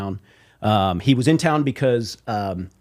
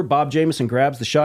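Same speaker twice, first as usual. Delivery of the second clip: usual speaking voice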